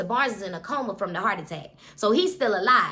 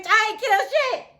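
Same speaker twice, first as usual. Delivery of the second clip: defensive Vontavious; 9 semitones higher than usual. Retold or imitated. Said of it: "Vontavious like".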